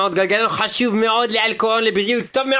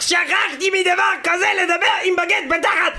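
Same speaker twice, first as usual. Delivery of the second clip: high excited shout; 8 semitones higher than usual. Acted. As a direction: yell it out with glee. Host shouting over-the-top greeting.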